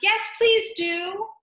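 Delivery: slow, high pleading chorus; chorus of birds. Acted. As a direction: plead together, drawn out. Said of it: "the others joined in chorus".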